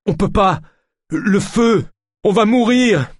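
very high, panicked cries; frightened wail. A narrator's reading. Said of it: "gémit Jacquenot".

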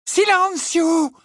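very high, drawn-out incantation; Hermione crying out. A narrator's reading. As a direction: cast the spell in a long cry. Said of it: "s'écria Hermione". (incantation criée, aiguë et étirée)

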